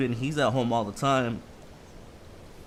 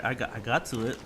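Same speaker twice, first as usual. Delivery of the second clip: ordinary talk, nothing marked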